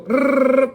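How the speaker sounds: a high, loud rolled rrrr like a bill counter; machine whirr. Retold or imitated. Telling how tellers cheat the bill counter, a high rolled whirr. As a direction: mimic the counting machine spinning.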